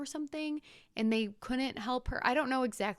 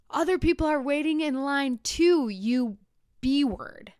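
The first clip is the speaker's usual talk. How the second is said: louder, higher yell of an impatient man; retold angry yell; 4 semitones higher, 6 dB louder than usual. Impatient passenger in line yelling at the screaming lady. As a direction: yell it at her from the queue. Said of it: "another passenger screams at this screaming passenger".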